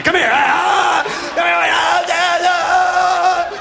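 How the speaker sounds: high, loud pained "ah" with a tongue pulled; tongue-yanked yelps. Retold or imitated. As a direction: yelp like a dad is yanking your tongue stud. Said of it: "your dad to grab a hold of".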